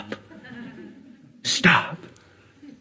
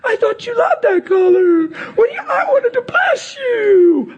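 hammed-up crying wail, much higher; mock sob shout, pitched up high. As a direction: fake a tearful freak-out over a gift. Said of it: "starts crying and freaking out and saying".

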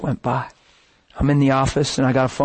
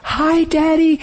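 put-on much higher, girlish "hi Daddy"; daughters' sweet greeting; 13 semitones higher, slower than usual. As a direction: lift into a tender little-girl voice. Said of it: "They're like".

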